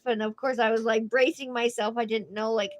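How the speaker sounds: normal talking voice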